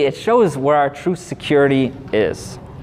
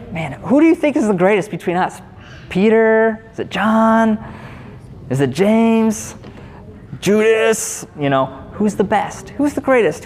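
higher, lightly voiced bickering disciples; squabbling disciples; 6 semitones higher than usual. Disciples argue who is the greatest, lightly mocked. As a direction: toss out names like boys arguing.

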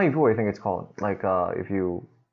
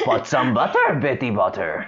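lightly voiced Yoda, words switched around, dropping low at the end; a hint of Yoda, inverted word order; 6 semitones lower than usual. Friend asks for Yoda, she tries a mild Yoda voice. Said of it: "Do it in Yoda's voice".

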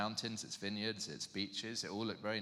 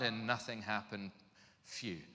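usual speaking voice

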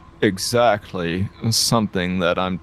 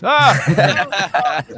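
evil laugh; 9 semitones higher than usual. Acted. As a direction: cackle with glee over the enemy. Villainous gloating laugh.

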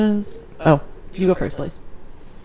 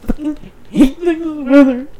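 whiny fake sob, drawn out; mock crying; 8 semitones higher, 3 dB louder than usual. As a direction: wail it like a hammed-up cry. Mock lament about cold weather.

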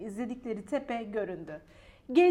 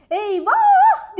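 high, loud alarmed cry; both friends scream and run; frightened shout; 12 semitones higher, 15 dB louder than usual. A narrator's reading. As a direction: yelp in fright. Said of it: "diye bağırdılar".

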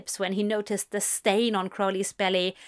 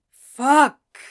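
loud sudden swear; angry curse; 3 semitones higher, 11 dB louder than usual. A narrator's reading. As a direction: blurt it as coffee splashes you. Coffee spills on him.

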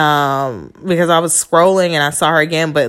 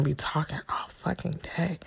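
muttered under her breath; a tired aside, barely voiced; whisper; 15 dB quieter than usual. Real, not acted. Noticing the video runs long, a weary whisper.

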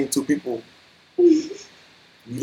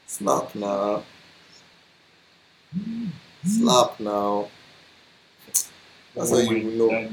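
quiet menace; 7 semitones lower, 8 dB quieter, slower than usual. Retold or imitated. Quiet mock threat.

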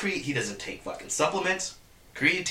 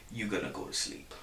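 imitating a quiet, ice-cold threat, much softer; 7 semitones lower, 12 dB quieter than usual. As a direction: drop the voice and say it flatly, without emotion. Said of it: "and he's ice cold".

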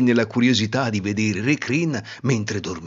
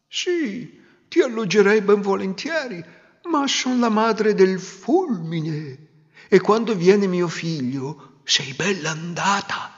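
lightly voiced, higher old woman; pitying old woman; 8 semitones higher than usual. Old mother of the wind pitying the girl, lightly voiced. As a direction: sigh with pity for the girl.

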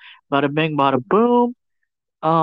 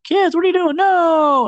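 mom yelling; 12 semitones higher than usual. Retold or imitated. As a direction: shriek at the kids in alarm, hammed-up. Mom yells at kids.

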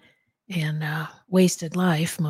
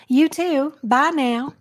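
put-on Southern accent; 7 semitones higher, 5 dB louder, slower than usual. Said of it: "let me compliment you on your Maybelle Jackson".

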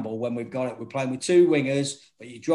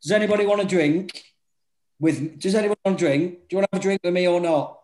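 re-enacted challenge to the room, pitched up; calling out; 5 semitones higher, 4 dB louder, faster than usual. Manager offering rival fans a drink, calling out. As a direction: put the offer to a whole bar, higher and insistent.

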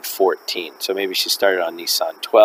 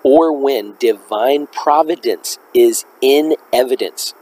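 slow, deliberate reading, pitched higher; slower repeat; 4 semitones higher, slower than usual. Real, not acted. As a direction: read it out slowly so every word lands. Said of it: "let me say that again slower".

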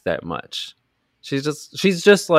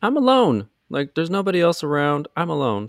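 mock alarmed girl; 8 semitones higher than usual. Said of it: "voicing the girlfriend in woods".

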